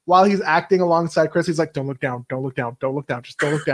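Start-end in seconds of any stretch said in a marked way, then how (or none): 1.7-3.7 soft, low, rapid self-talk; self-coaching under breath. Acted. Actor to himself while acting alongside Chris, under his breath. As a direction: repeat it quietly, an actor steadying himself. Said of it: "he has to, like, tell himself".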